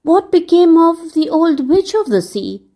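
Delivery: put-on an eager small boy asking; a hint of a puzzled young boy. Dick asking the lady, a touch breathless. Dick asks about the witch.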